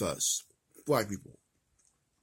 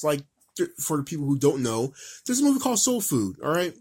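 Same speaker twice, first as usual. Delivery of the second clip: normal talking voice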